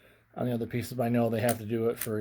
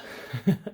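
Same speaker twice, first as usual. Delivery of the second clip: short airless chuckle; quick winded laugh; breathy laugh; 5 semitones higher than usual. Real, not acted. Laugh inside breathless talk.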